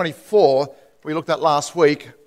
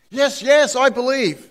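higher, urgent, frantic agreement; eager desperate yes; 7 semitones higher, slower than usual. Acted. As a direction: insist you believe, begging. Desperate man assures God.

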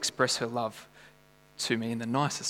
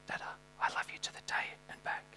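fast, hushed whisper; 14 dB quieter, faster than usual. Retold or imitated. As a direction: whisper it tenderly like a child. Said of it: "and she'd whisper".

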